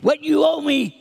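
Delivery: high, forceful mock shout; demanding. Servant choking his debtor, acted angry demand. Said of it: "he demanded".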